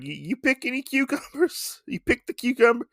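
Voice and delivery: put-on high, quavering old woman asking; imitating the old woman, pitched high. Host mimicking the old lady asking Usagi, high pitched. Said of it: "She says".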